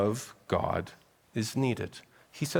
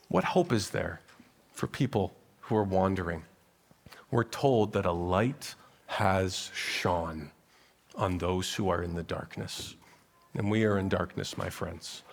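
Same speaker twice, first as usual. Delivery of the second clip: hushed and somber; 5 semitones lower, 5 dB quieter than usual. Acted. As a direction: let it sink into the dark. Somber turn to darkness.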